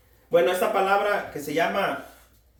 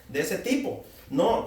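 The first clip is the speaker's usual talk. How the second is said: normal talking voice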